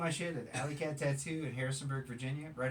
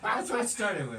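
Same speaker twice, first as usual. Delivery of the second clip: words squeezed out through laughter; laughing; 8 semitones higher, 8 dB louder than usual. Real, not acted. Laughing along at a friend's story. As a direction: talk while still cracking up.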